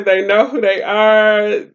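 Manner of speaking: normal talking voice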